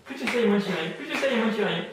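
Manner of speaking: loud, high hammered chant; insistent suggestion. Real, not acted. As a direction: cut across their counting with forceful repetition. Hypnotist hammers suggestion during counting. (suggestion martelée d'une voix forte)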